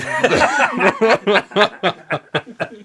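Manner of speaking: helpless real laughter; laughing. Real, not acted. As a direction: keep laughing at the blooper. Blooper laughter continues.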